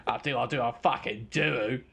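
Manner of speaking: playful Australian impression; mock Australian accent. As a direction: stretch it in an Australian twang. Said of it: "Aussie, Aussie words".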